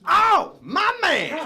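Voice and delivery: very high, loud hyped shout; hammed-up, overjoyed clerk holler; super-pumped clerk. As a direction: shout it like a thrilled hype man. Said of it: "He was super pumped".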